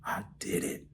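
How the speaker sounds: soft, barely voiced, crying with joy; overwhelmed, quiet sob of relief; tearful joy. Real, not acted. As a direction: whisper it through tears of triumph. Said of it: "tears was of joy".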